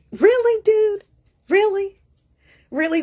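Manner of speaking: high-pitched, disbelieving challenge; incredulous. Retold or imitated. Teller answering the angry patron's rant, incredulous. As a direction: throw each "really" up high in mock disbelief.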